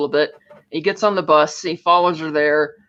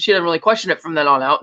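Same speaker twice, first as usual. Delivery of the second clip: normal talking voice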